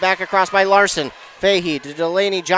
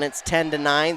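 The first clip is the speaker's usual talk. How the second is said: ordinary talk, nothing marked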